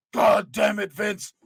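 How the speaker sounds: botched impression. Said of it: "I lost it".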